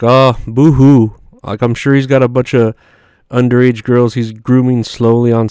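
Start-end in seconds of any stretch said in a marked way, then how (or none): 0.0-1.1 slow, sarcastic fake crying, a bit louder; mock the pop star's sadness; mock boo-hoo. Comedian sneers at [name]'s trouble, mock crying slow. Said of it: "and I'm like".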